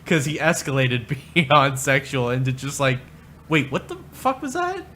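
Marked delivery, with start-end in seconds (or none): 3.5-4.8 high-pitched startled question; baffled double take. Acted. Reacting to sudden talk beyond sexual, baffled double take. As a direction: squeak it out in mock disbelief.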